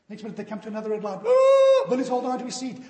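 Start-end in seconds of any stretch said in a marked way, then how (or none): 1.2-1.9 high, loud shocked cry; startled yelp. Retold or imitated. Passenger yelping as they near another red light. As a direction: yelp in fright.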